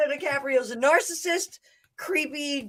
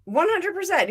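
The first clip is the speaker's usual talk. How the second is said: usual speaking voice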